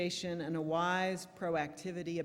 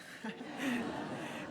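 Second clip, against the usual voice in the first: laugh; 8 semitones higher than usual. Real, not acted. Laughing at own joke.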